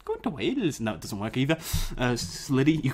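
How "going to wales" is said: Welsh accent. Shown as a demonstration. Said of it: "Pitch perfect Wa-, Welsh accent there".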